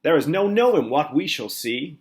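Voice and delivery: loud eager centipede cry. A narrator's reading. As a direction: call out ready for adventure. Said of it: "cried the centipede".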